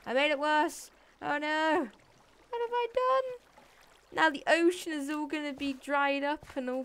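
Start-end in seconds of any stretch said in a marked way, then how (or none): 0.1-1.9 a higher, louder cry of alarm; panicked shout at his own blunder. Real, not acted. Panics over flooding. 2.5-4.2 very high, slow, drawn-out wail; hammed-up wail over the ruined ocean; mock dramatic wail. Ocean draining into hole.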